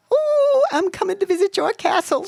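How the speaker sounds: very high, playful pretend queen; high queenly voice. A narrator's reading. Girl playing queen announces a castle visit, high queenly voice. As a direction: sing out grandly like a little queen. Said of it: "said in a high voice like a queen".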